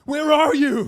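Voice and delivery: very high-pitched, loud superhero shout; Batman. Shown as a demonstration. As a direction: bellow it in a put-on movie vigilante voice. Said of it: "what I like to call the Batman voice".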